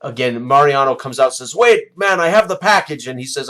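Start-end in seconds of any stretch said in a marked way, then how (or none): 1.5-3.1 higher, louder call across the tavern; calling after him. Acted. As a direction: shout after someone already leaving. Barkeep calls after departing man.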